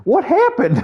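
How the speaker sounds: very high, loud baffled cry; bewildered. Retold or imitated. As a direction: squeak it out, totally lost. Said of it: "He's all bewildered".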